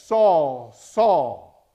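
loud, ringing call of a name. Retold or imitated. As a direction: call Saul as God from heaven. God calling Saul by name.

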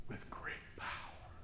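whispered With great power; hushed dramatic reveal. Acted. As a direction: breathe it as a secret.

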